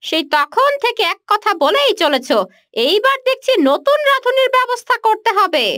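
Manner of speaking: high, sharp scolding of a servant; irritated threat. Acted. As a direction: threaten to sack the cook. Mistress threatens to replace cook. (বিরক্ত হয়ে ধমক)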